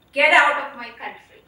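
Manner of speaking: loud, forceful hostile command; retold angry order. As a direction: throw it out hard and loud, imitating him. Retold hostile man confronts her.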